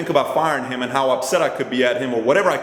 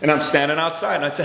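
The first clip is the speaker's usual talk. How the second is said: normal talking voice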